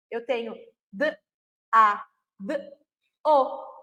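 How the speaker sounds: "d", "a", "d", "o" said one by one with pauses; segmenting the word for a child; phoneme segmentation. Shown as a demonstration. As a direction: sound out "dado" letter by letter. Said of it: "que são representados pelas letras".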